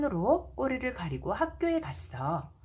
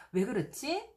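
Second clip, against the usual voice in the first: plain reading voice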